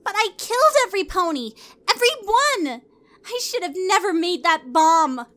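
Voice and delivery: high, fast, panicked protest; horrified cry. A narrator's reading. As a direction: blurt it out in rising horror. Twilight horrified at confession.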